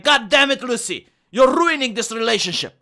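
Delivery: hammed-up furious boyfriend yelling; angry shout. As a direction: blow up at her in frustration. Acted quarrel, fiance crying.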